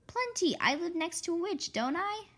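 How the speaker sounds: high, smug brag; boastful. Acted. Karen bragging she knows witch spells. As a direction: toss it off proudly, up high.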